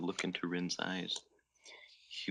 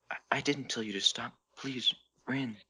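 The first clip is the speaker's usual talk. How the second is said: begging; 5 semitones higher than usual. Acted. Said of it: "Haru begged".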